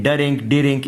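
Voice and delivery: डरिंक, डिरिंक each split into two syllables; "da-rink, di-rink", vowel after the d; wrong added vowels. Shown as a demonstration. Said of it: "इस तरह नहीं करना".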